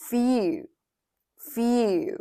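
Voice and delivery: "feel" said as fyoo, with a y before the oo; y glide before oo. Shown as a demonstration. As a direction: say "feel" as a clear, falling fyoo. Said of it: "ออกเสียงว่า". (ออกเสียง "feel" เป็น ฟิว มียอยักษ์หน้าสระอู)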